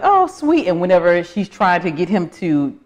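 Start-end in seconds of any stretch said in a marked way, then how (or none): 0.0-0.7 sugary switch. Retold or imitated. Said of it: "and then she's like".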